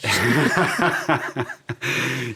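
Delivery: a real burst of loud laughter; big genuine laugh; hearty laugh. Real, not acted. Laughs at rain in Irish joke.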